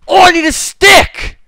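high, slow urgent yell; urgent shout. Real, not acted. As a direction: call out for help urgently. Urgent call during fight.

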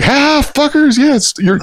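high giddy taunting squeal; gleeful squeal. Retold or imitated. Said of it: "he's just like".

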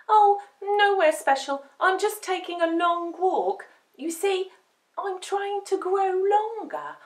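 high little puppy voice; earnest young pup confiding; chatty puppy. A narrator's reading. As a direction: explain brightly, pitched high. Dumpling chats to cat.

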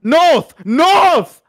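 high, very loud repeated yell; frantic shouting. Real, not acted. Player yelling location to partner, frantic shout. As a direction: bellow the answer urgently at top pitch.

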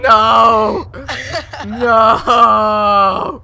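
loud shocked cry; shout. Real, not acted. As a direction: yell it out in shock. Shocked scream in game.

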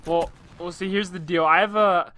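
ordinary talk, nothing marked

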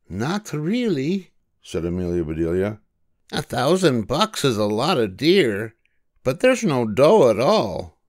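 high, cheerful storybook voice for Amelia; Amelia Bedelia voice. A narrator's reading. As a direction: lift into a bright girlish character voice.

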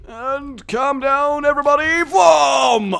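high, loud, drawn-out call; slow sing-out into an explosive shout; mock announcement. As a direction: build up to a shouted boom. Calls out as he fires attack.

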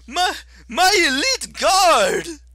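whiny high-pitched fury, dragged out; Octavian, shrill and outraged. Acted. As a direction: screech like a wounded petty tyrant. Octavian character voice.

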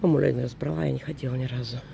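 usual speaking voice

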